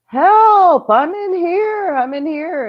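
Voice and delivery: high-pitched calling out, loud then fading; mimicking a man's yell for help, trailing off; imitated cry for help. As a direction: call out like someone stuck in another room. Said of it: "we hear this guy".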